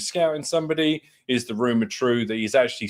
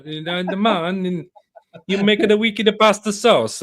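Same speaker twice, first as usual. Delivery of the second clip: put-on a playful stab at an Italian accent; mock Italian accent; 6 semitones higher than usual. Podcaster imitating a footballer's nonna talk, mock Italian accent.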